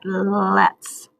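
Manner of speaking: let's drawn out so every sound is heard; let's said slowly and clearly. Shown as a demonstration. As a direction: stretch let's so the children can listen. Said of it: "Listen to that".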